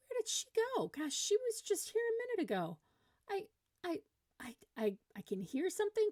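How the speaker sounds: very high, exaggerated bewilderment; pretend searching. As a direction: play baffled by a child who vanished. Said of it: "So I did the".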